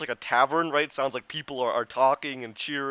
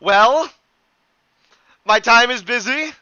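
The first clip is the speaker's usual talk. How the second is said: higher and louder, brusque; loud, curt otherworldly being; impatient spirit; 8 semitones higher, 11 dB louder than usual. Acted. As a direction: brush them off, he has no time. Spirit cuts them short.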